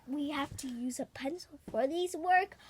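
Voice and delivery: quick whispered aside; fast whispered aside. Real, not acted.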